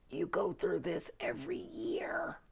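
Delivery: whispered with a laugh, like sharing a secret; teasing whisper. Real, not acted. Teasing her fellow Scorpios about a yearly secret, giggly whisper. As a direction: let it slip out in a giggly whisper.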